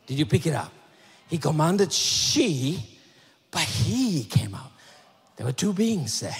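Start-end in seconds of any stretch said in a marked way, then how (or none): 1.3-4.7 very slow, "she" drawn out, then "he" held; stress on pronouns. Shown as a demonstration. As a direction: set "she" against "he" with long emphasis. Repeats the pronoun contrast for listeners.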